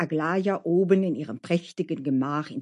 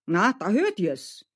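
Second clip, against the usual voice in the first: slow, emphatic praise, growing louder; admiring cook Mascha; 5 semitones higher, 5 dB louder, slower than usual. Acted. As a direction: flatter the woodcutter with relish. (bewundernde Köchin, langsam und nachdrücklich)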